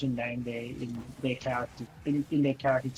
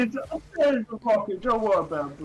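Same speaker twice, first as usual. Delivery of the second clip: put-on New York accent; 5 semitones higher than usual. Co-host teasing his partner in a mock New York accent. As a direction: drag out the apology like a Brooklyn wiseguy. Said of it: "the New York accent that you're trying to do".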